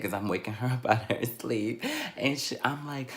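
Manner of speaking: ordinary delivery, nothing marked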